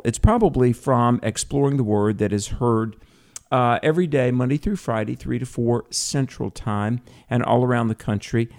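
ordinary talk, nothing marked

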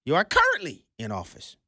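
usual speaking voice

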